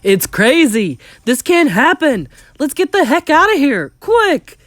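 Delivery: loud cries, climbing in pitch; panicked shouting. Acted. Men shouting to flee the mice, panicked.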